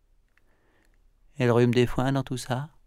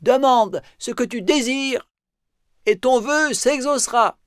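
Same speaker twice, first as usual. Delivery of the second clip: fée qui accorde un vœu; 9 dB louder than usual. Acted. Mila parle au dragon.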